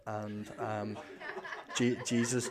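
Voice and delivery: quiet, embarrassed laughter through words; nervous laugh. Retold or imitated. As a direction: laugh nervously while faltering.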